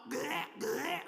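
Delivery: mock cat coughing up a hairball; cartoonish hacking gags; hairball retching. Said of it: "gonna go hack up a hairball".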